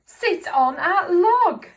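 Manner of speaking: slow, loud, high-pitched order; angry cat voice. Acted. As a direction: drag out the cross command loudly. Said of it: "Right, I'll do it again".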